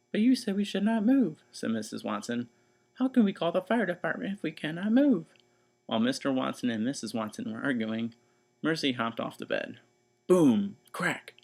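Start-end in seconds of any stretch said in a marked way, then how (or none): 0.1-5.3 1 fretful wife. Acted. Missus Watson character voice. 10.3-10.8 1 loud boom. A narrator's reading. Sound effect.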